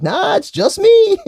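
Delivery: high, slow mocking Buff voice; a hammed-up high squeak of Buff; mock Buff quote. Mocking the wrestler's video, high and slow. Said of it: "Buff just posted a video like".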